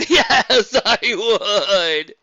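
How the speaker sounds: loud laughing yes. Real, not acted. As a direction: laugh while admitting it. Laughing admission in chat.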